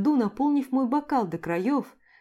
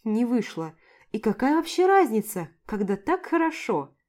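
plain reading voice

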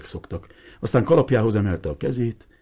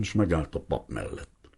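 ordinary delivery

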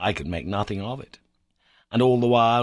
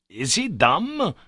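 puzzled cry; 7 semitones higher, 4 dB louder, slower than usual. A narrator's reading. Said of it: "cried the professor".

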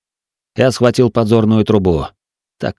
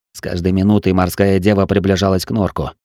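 plain reading voice

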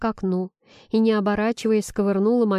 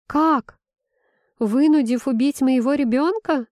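higher, rising incredulous challenge; indignant outburst; 5 semitones higher, 4 dB louder than usual. A narrator's reading. Heroine accuses him over her baby, indignant. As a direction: throw it back, outraged.

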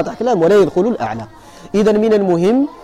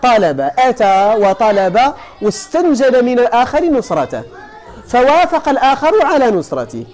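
ordinary delivery

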